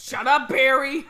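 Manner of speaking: loud, slow, rising snap at the pretend kid; mock shout. Mock parent yelling slow at pretend son Barry. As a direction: bark it at the kid.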